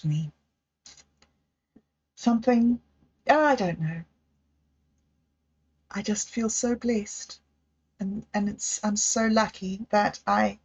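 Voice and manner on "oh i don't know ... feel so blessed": usual speaking voice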